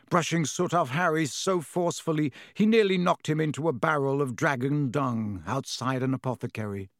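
ordinary delivery, nothing marked

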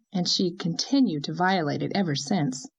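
usual speaking voice

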